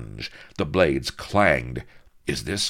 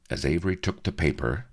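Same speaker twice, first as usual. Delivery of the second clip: plain reading voice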